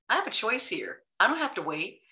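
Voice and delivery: usual speaking voice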